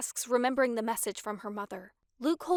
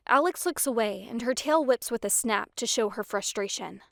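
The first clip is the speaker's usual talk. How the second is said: usual speaking voice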